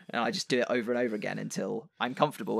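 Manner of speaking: ordinary talk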